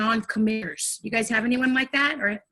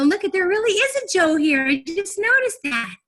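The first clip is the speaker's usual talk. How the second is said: high, squealed greeting to someone imaginary; playful mock discovery; 9 semitones higher, 3 dB louder, slower than usual. Trainer spots an imaginary Joe in the room, playful high squeal. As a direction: act delightedly surprised, pitched way up.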